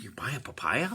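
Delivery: whispered mock disbelief; breathy incredulous whisper at the price. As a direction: hush it like a shocked aside. Mock surprised aside.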